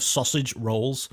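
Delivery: sausage rolls drawn out slowly, each word separate; slow and specific. Retold or imitated. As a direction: imitate mum saying "sausage rolls" very deliberately. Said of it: "she said it so slowly and so specifically".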